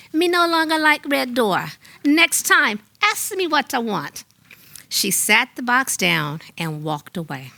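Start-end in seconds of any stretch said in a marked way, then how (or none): 0.1-4.2 put-on high Jamaican accent with slight sarcasm; sarcastic Jamaican mother's voice; Mama Till, hint of sarcasm. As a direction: dismiss the gift in a Jamaican accent, pitched well up. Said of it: "with a small hint of sarcasm saying".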